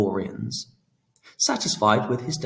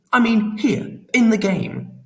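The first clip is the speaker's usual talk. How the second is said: retort; 12 semitones higher, 6 dB louder than usual. A narrator's reading. Phoenix retorting to Pan, impatient and louder. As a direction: snap back, offended. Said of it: "Phoenix retorted".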